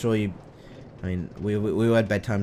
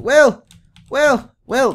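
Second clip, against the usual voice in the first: calling out; 13 dB louder, much slower than usual. Real, not acted. Trying to wake Will.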